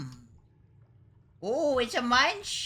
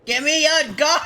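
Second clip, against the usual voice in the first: loud, high, laughing plea; raised cartoon begging; shouted demand; 5 semitones higher, 8 dB louder than usual. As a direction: holler for it urgently. Demanding a gun in game.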